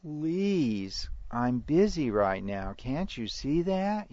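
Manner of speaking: mock plea, loud and rising high; exaggerated parental pleading. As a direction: beg like a worn-down parent, stretching the words. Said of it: "comes from a pleading parent that says".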